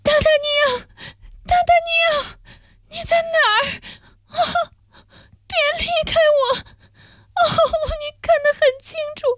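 very high, weeping calls for d'Artagnan; cling to him through sobs, fast; dying cry. Acted. Madame Bonacieux cries out to d'Artagnan as she dies, high and weeping. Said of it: "伯纳修太太嚷道".

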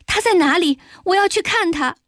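high-pitched, insistent demand; urgent confrontation. A narrator's reading. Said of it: "反而冲到他面前说". (冲上前高声急切追问)

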